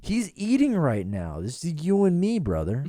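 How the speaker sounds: playful high mock-camaraderie; high teasing. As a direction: rib your buddy in a high voice. Teasing co-host.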